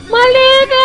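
high loud shriek, then curse; terrified scream. Real, not acted. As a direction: scream in real fright. Monster jump scare.

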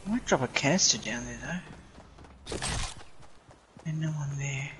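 low, secretive musing; hushed plotting. Real, not acted. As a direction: think it through under the breath. Plotting quietly near enemies in game.

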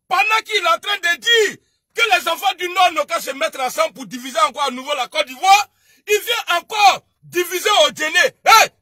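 loud indignant shouting; angry shout. Real, not acted. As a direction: denounce it at full voice. Denouncing division on stream. (dénonciation criée, forte)

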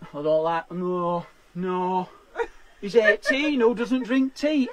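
low, disapproving dad voice, lightly done; imitating her dad. Dad doubting a boyfriend who won't drink tea, low mock disapproval. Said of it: "And he went".

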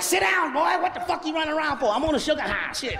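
put-on mama scolding her running boy; mom yelling at kid. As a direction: yell at your hyper son to sit down. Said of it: "Your mama like".

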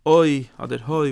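loud drawn-out cry of אוי with a rising end; cried-out oy. Shown as a demonstration. Preacher showing the cry of the letters, loud and slow. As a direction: call out אוי as if crying to heaven. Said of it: "ווען מען שרייט".

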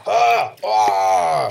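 loud pained cries as the pelt takes hold; cries of transformation. Acted. A hero pulling on a stinky pelt, crying out as he changes. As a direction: cry out as the body twists.

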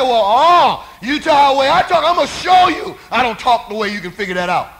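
loud, high, blustering denial; preacher acting out a cornered, riled disciple; indignant retort. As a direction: shout back at the accuser. Peter angrily answers his accuser.

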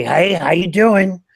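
slow, lightly voiced puppet monster; Telly Monster voice. Shown as a demonstration. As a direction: greet them as Telly Monster. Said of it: "your Telly Monster".

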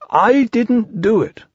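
high, slow bear voice; high drawn-out storybook protest; defensive Pooh. A narrator's reading. Pooh denies blame.

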